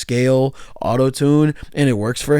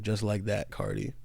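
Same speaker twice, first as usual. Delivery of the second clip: low, muted "Just like that, [name]", fading on "[name]"; quiet, bemused quote of "Just like that, [name]"; 3 semitones lower, 11 dB quieter than usual. Retold or imitated. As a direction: murmur "Just like that, [name]" softly, in disbelief. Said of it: "how the youth will perceive him saying".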